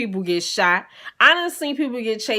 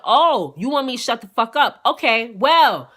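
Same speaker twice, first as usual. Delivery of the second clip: louder defiant retort; 4 semitones higher, 5 dB louder than usual. Retold or imitated. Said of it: "So I said".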